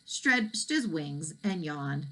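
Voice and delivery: usual speaking voice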